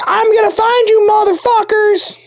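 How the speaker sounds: high-pitched furious yelling, imitating her; angry woman. Angry woman threatening whoever answered her phone, furious shrill yell. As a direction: screech it like a woman raging down the phone. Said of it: "was an angry woman".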